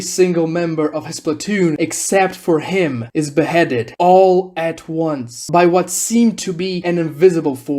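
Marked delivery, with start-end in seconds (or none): none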